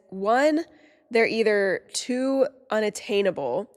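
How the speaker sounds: usual speaking voice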